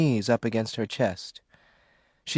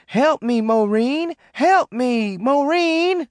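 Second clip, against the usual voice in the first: frantic; 9 semitones higher, slower than usual. Acted. John begging Maureen to pull him out. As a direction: beg for rescue in panic. Sinking man calling for help.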